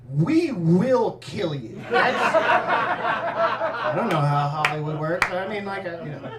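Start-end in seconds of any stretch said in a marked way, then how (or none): 0.1-1.8 slow, louder mock menace; mock threat. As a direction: deliver a drawn-out, hammed-up threat. Imagined studio threat to the girl.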